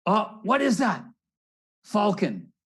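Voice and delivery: high, loud startled cry; alarmed bird voice. Acted.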